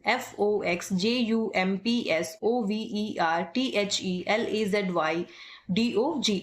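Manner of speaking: slow, each letter spoken apart, टी एच ई space Q यू; spelling letter by letter. Shown as a demonstration. Typing teacher showing students the right way, slow letter-by-letter spelling. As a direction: spell out every letter slowly. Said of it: "इस तरीके से आपको alphabets को पढ़ना है".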